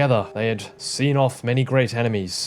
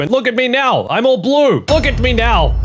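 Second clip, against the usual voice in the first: higher, louder indignant shouting; mock outrage; 10 semitones higher, 6 dB louder than usual. Ghost Anakin yelling at Obi-Wan, higher and louder. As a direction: yell it like a wronged ghost.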